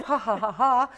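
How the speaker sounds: loud, spoken-out mock laugh; put-on stagey ha-ha-ha; fake laugh. Shown as a demonstration. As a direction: pretend to laugh, ha by ha. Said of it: "or laugh".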